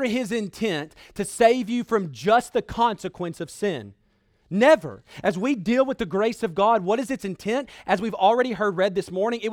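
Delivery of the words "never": "Never" punched out loud on its own; emphatic denial. Real, not acted. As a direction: hit "Never" hard, then let it fall. Emphatic denial standing alone.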